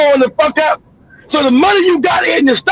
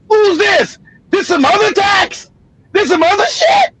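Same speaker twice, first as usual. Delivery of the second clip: put-on raised, indignant teenage squawk at the paycheck; a kid pitched up in disbelief at his stub; mock indignant outrage; 6 semitones higher than usual. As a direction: play your fifteen-year-old self outraged at the deductions. Retold teen reading first paycheck.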